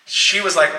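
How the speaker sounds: usual speaking voice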